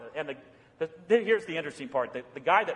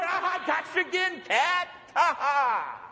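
much higher, hammed-up triumphant taunt; gloating at the cat; 9 semitones higher than usual. Pastor crows at his cat, hammed-up mock triumph. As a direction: crow like a kid who won.

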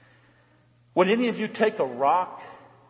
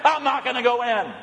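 a higher, stubborn refusal from the bitter son; cold refusing son; 8 semitones higher than usual. Acted. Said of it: "A cold, bitter heart".

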